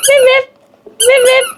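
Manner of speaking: high toy-horn beeps; playful horn noise. Pretend. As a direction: honk like a little car, pitched high. Imitating a horn for child's ride-on.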